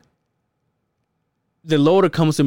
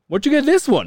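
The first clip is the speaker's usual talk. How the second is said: much higher, accusing question; suspicious inspector; 12 semitones higher than usual. Retold or imitated. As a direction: mimic the inspector doubting the new load. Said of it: "So then he goes".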